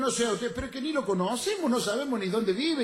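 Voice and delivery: high, pleading comic objection; indignant protest. Acted. Customers refuse to take stranger, exasperated high voice.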